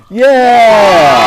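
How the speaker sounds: high, loud cheer. Acted. Storyteller leading the children in a loud cheer. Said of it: "Let's cheer".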